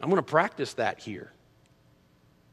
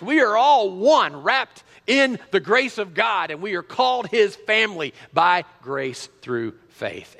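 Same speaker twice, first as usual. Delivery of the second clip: rising preacher's proclamation, louder and higher; fervent preaching; 7 semitones higher, 6 dB louder than usual. Acted. Preacher proclaiming to the congregation, fervent and swelling. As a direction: preach it out to the whole room, slowing on the close. Preacher builds to full force.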